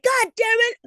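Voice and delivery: high, loud mock exasperated mom; imitating mad mom. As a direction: squeal it like a fed-up mother. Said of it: "And she's like".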